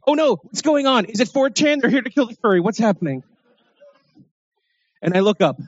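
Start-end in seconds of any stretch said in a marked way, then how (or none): 0.1-3.2 high panicked inner voice; rising panic, pitched way up. Retold or imitated. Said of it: "And I'm thinking".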